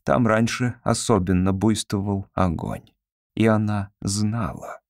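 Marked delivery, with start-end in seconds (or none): none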